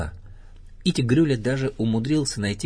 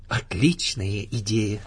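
slow, drawn-out pleased approval; delighted; slower than usual. A narrator's reading. Kanga approves Pooh's Santa plan, mildly delighted and slow. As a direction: savour the idea with understated delight. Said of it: "обрадовалась Кенга".

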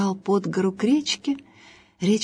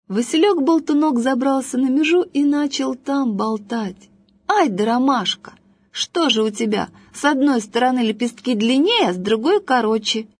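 put-on higher, chattering boy voice; chatty little boy; 6 semitones higher, 3 dB louder than usual. A narrator's reading. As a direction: rattle on like a nosy kid.

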